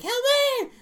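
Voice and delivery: put-on very high, loud girlish shriek; screaming little girl. Parrot screaming for help, in a little girl's shrill scream. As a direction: scream for help like a small child. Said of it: "screaming, like, in a little girl's voice".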